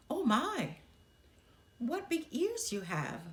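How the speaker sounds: put-on a little girl marvelling at granny; Little Red, wide-eyed. Little Red talks to disguised wolf.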